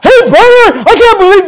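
high, loud accusing outburst; accusing confrontation. Shown as a demonstration. The wrong way to approach a brother, high and loud. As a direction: lay into him, high and loud. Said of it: "no, no, no".

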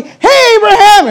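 high, bright calling out; cheery hail. Acted. Abimelech greets Abraham unaware of trouble, calling out high. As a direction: hail a neighbour across the field, high and loud.

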